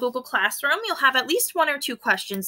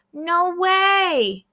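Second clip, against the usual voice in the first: amazed echo; 8 semitones higher, 4 dB louder than usual. A narrator's reading. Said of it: "echoed Chemo".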